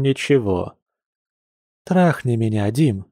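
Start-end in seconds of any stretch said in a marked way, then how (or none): none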